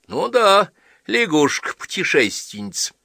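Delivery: high, loud mocking quip; playful tease. A narrator's reading. Wife joking about the travelling girl, high and loud. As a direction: toss off the joke.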